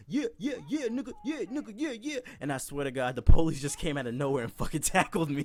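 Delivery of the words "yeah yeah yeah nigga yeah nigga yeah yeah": high, pumped chant of yeah; cheering himself on mid-gym; hyped flexing. Retold or imitated. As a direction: soak up the crowd's cheers.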